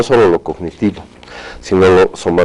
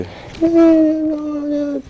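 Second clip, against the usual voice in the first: put-on very high, small child voice; child's voice; much slower than usual. Therapist imitating a regressed patient speaking as a little child. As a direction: talk like a little kid regressed in hypnosis. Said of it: "empiezan a hablarte incluso con la voz de".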